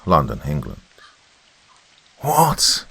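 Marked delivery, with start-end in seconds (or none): 2.2-2.9 high-pitched exclamation; shouted disbelief. A narrator's reading. Admiral reacts to a top-secret document, shouting. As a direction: burst out in disbelief. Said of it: "shouted Admiral Sir Radabar".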